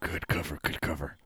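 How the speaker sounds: whispered aside off mic; hushed aside. Acted. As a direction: say it low, to your partner.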